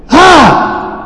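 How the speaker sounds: usual speaking voice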